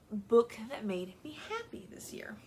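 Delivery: reading the question in a whisper; whispered aside reading the next prompt. Real, not acted. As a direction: whisper it to yourself, like reading off notes.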